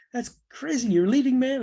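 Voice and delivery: high, exasperated retelling of a protest; incredulous outburst. Retold or imitated. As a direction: imitate a producer who can't believe it. Frank protests again.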